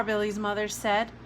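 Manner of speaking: plain reading voice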